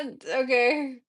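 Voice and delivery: usual speaking voice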